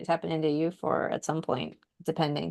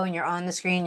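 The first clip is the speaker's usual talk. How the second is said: normal talking voice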